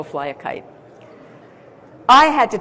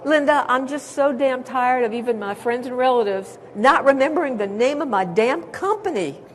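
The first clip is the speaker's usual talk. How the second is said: put-on Southern Georgian accent, a touch higher; 4 semitones higher than usual. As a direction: play a fed-up Georgia CEO in a Southern accent. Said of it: "I don't know if I'm doing a good Georgian accent".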